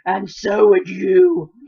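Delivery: loud, slow, wretched retort; miserable outburst. A narrator's reading. Boy turns on the girl, loud and miserable. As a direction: hurl it back like a miserable boy. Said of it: "like a boy who was so miserable he didn't care".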